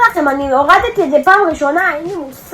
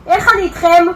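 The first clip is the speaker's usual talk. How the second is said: usual speaking voice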